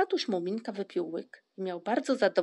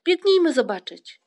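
loud, high rallying cry; excited shout; 8 semitones higher, 12 dB louder than usual. A narrator's reading. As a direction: shout high, urging everyone to come. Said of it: "krzyknął Włóczyki".

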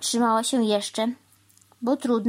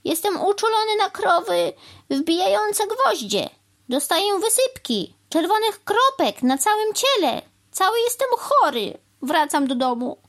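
very high, agitated whining rant; melodramatic complaint; 12 semitones higher, 4 dB louder than usual. A narrator's reading. Crow claiming an allergy to hammering cows, melodramatic. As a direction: pile up symptoms hysterically, then drop on the last line.

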